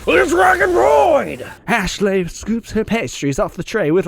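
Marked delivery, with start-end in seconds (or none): 0.1-1.5 high, loud, slow battle cry; special move shout. Acted. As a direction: yell the move name like an anime attack. Calling out a special cooking move.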